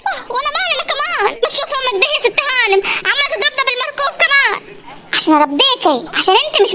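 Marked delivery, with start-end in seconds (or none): 0.1-4.7 pained complaint. Acted. Hit with slipper, complaining.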